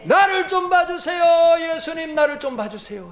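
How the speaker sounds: high pleading shout; desperate crying out. Acted. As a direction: plead at the top of your voice. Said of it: "소리 질러요".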